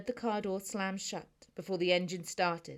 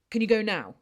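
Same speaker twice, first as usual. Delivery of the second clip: louder, pushy question; impatient urging; 3 semitones higher, 10 dB louder than usual. Acted. Anxious brother urges Noah to drive him immediately. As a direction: press him to leave right now.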